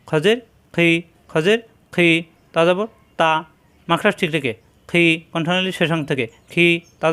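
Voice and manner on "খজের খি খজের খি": normal talking voice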